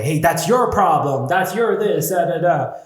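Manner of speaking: loud jeering shout from a stranger; mock yell. Stranger in a car heckling him, loud jeer. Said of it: "He's like".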